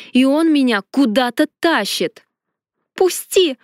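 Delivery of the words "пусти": high shout to be let go. A narrator's reading. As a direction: cry out, struggling. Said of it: "закричала я".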